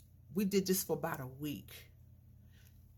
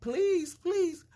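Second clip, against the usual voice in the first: high, loud repeated pleading; store owner begging; 12 semitones higher, 5 dB louder, much slower than usual. Retold or imitated. Store owner begging protesters, high loud pleading. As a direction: beg him to stop, pitched way up and loud. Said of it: "He was like".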